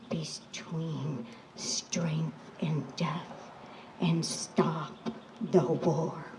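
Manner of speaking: slow, grave whisper. Acted. Storyteller to the children, whispering the lesson. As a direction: breathe the words like a solemn secret.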